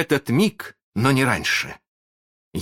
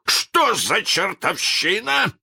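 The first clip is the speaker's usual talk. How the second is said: high, slow angry demand; thundering outburst; 4 dB louder, slower than usual. A narrator's reading. Hook thunders at his pirates, high angry outburst. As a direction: demand it in drawn-out, high-pitched rage. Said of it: "загремел Крюк".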